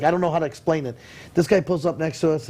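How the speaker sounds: ordinary talk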